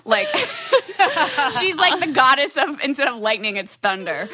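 cracking up while teasing; laughing. Real, not acted. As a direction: laugh through the joke. Teasing friend, breaks into laughter.

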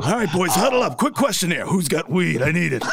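raspy coach-in-a-huddle impression; rally the players in a smoker's rasp; Phil Jackson. Coach asking players for weed, joking raspy imitation. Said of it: "Phil Jackson's been known to smoke".

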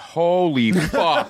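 high, slowly stretched amazed exclamation; starstruck awe. Retold or imitated. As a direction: retell someone utterly floored by a celebrity. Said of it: "the most starstruck I had ever seen".

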